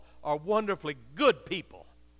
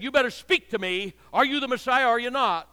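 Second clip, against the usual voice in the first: loud, higher demand to answer; angry shout; 6 semitones higher, 7 dB louder than usual. Retold or imitated. Preacher voicing the high priest shouting at Jesus, loud and higher. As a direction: bark it as the furious high priest. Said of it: "he angrily shouted at him".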